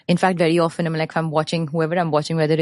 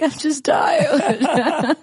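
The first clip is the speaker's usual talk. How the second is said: weepy, laughing; 7 semitones higher, much slower than usual. Retold or imitated. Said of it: "And they're like".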